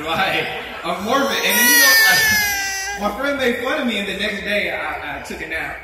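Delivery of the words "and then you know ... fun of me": hammed-up whiny sob; mock crying. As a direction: fake tearful complaint, higher. Mock crying about being teased.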